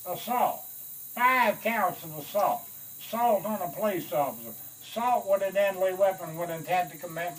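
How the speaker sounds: loud, high outraged reading of charges; indignant colonel. Retold or imitated. Colonel angrily reading out the crew's assault charges. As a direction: jab at each charge in outrage.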